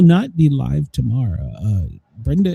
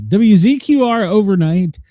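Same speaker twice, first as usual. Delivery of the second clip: ordinary talk, nothing marked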